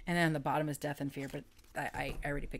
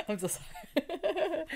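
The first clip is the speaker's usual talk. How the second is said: high, drawn-out words through real laughter; helpless giggly squeak; laughing apology; 12 semitones higher, slower than usual. Real, not acted. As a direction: apologize while cracking up. Cracking up after singing.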